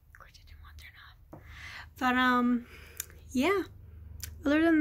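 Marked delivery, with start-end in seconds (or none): 0.1-1.4 soft whispered aside; whispering. Real, not acted. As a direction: whisper it under breath. Aside under her breath.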